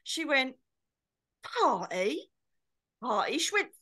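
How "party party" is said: "Party?" drawn out, swooping up in query; mum correcting speech. Acted. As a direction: stretch "Party?" as an incredulous correction. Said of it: "trying to educate me in speaking proper".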